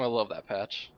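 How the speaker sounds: normal talking voice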